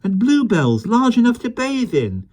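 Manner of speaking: put-on high, excited boy voice; a boy stunned by a giant world; amazed boy. Michael amazed.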